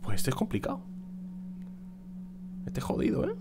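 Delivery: quiet, half-whispered comment; hushed. Real, not acted. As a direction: mutter it under the breath. Hushed after tough boss.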